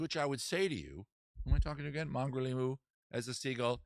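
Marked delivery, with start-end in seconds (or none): none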